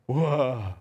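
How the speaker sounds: low, spaced-out stoner whoa; dazed stoner. Retold or imitated. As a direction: drop low like a stoned teen. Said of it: "people who do pot are like".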